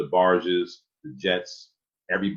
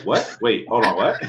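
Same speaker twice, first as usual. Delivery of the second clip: high, loud mock disbelief; hammed-up double take; startled confusion; 4 semitones higher, 6 dB louder than usual.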